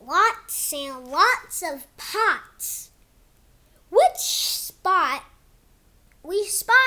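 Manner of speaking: slow, sing-song storybook read; reading to kids. Acted. Kid reading his book to viewers in storybook voice. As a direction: read it like a bedtime book for little ones. Reads picture book aloud.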